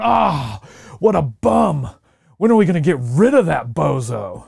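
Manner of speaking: loud mocking fan yell at the quarterback; jeering fan. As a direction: boo the bum like a rowdy sports fan.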